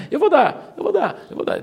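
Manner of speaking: mock the emotive giver overpromising; emotional promise. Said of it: "o brasileiro é muito emotivo".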